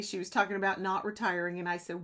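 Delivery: ordinary talk, nothing marked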